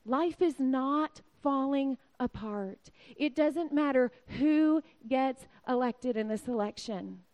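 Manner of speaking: normal talking voice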